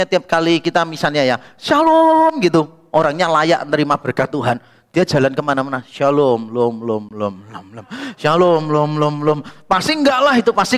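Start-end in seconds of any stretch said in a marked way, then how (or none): 1.6-2.4 high, long-held greeting call; demonstrated greeting. Shown as a demonstration. Greeting someone to bless them, demonstrated high greeting call. 5.9-7.9 mock echo. Greeting follows a person everywhere, mock fading echo. 8.2-9.4 repeated last syllable bouncing like an echo; mock echo. Greeting follows a person everywhere, mock echo. Second imitation of the echoing greeting.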